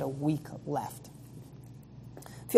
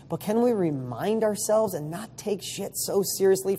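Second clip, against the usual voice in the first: ordinary talk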